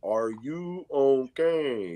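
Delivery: very drawn-out question; slow, careful check. Retold or imitated. Neighbor checks on ranting kid, slow and deliberate. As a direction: stretch each word as if checking on someone.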